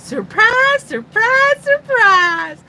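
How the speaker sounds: loud, high North Carolina drawl impression; Gomer. Host doing a viewer's requested catchphrase, loud North Carolina accent. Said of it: "more like Gomer's North Carolina accent".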